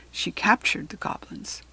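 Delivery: ordinary delivery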